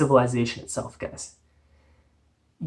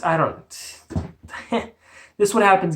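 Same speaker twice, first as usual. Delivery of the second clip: ordinary talk, nothing marked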